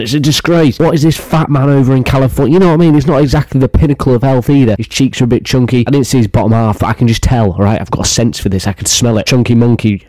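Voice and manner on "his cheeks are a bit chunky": ordinary talk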